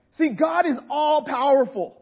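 usual speaking voice

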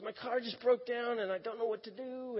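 high, mildly tearful retelling; light mock-weepy plea; imitating crying. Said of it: "She's just crying".

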